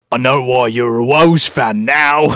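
slow, drawn-out mimicry; Hemi. Host mocking Hemi's jab at Chad, in a slow put-on voice. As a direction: put on his voice and stretch each word. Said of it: "Who likes my Hemi impression".

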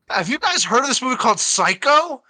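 high, loud mock-innocent tone; mock-naive teasing. Mock naive question teasing co-host.

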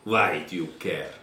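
put-on defiant prisoner with a thick Russian accent, dropping articles. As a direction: scorn the officer in a Russian accent. GM voices Bolshevik prisoner.

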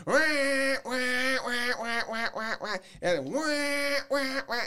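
high ringing wah wah calls, loud; high ball duck call. Shown as a demonstration. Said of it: "putting a long quacking call out there".

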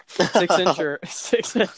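slow, laughing through the words; can't get the words out for laughing. Retold or imitated. Friend retelling Jennifer's question, laughing through it.

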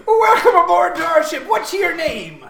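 loud, high pirate hail. Acted. Pirates greeting a newcomer aboard, loud pirate voice. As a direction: boom out a theatrical pirate welcome. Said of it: "The pirates say".

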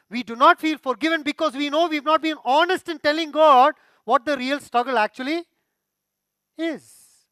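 loud, high preaching climax; pulpit-pounding sermon delivery; fiery preaching. Acted. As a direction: proclaim it to the whole hall. Preaching at full force.

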